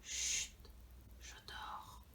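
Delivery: soft unvoiced whisper; whispering over a crib. Real, not acted. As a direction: hush so as not to wake him. Raymond asleep, whisper near him.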